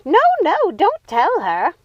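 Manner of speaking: high, loud scream, slowing at the end; frantic stop-her scream. Acted. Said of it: "The odd sisters screamed".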